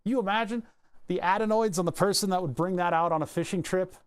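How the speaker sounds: ordinary talk, nothing marked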